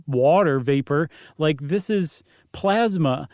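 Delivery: ordinary delivery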